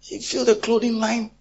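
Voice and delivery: high, loud shouted neighbour squabble; acted shrill quarrel. Preacher acting women fighting over the clothing line, shouting. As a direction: yell back at the gossiping neighbour.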